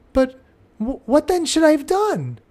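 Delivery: confused little pupil asking back; puzzled child. Retold or imitated. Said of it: "The child will almost always run up".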